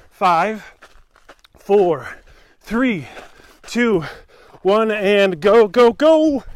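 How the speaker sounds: counting down, building to yell; rally the runner loudly; coaching shout. Real, not acted. Counting down interval start.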